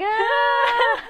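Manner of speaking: high, loud delighted squeal; gleeful high squeal; excited squeal. Retold or imitated. Squeal at a kiss scene.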